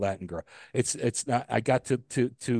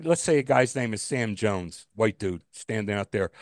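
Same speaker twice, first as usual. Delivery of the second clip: ordinary delivery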